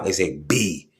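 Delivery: usual speaking voice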